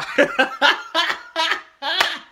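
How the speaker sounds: high screaming laugh; shrieking laugh. Real, not acted. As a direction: burst into a loud shriek of laughter. Burst of laughter.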